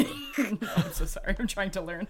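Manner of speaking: giggling through an embarrassed apology; laughing apology. Real, not acted. Player laughing at her own blunder, apologising while giggling. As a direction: laugh while you say sorry.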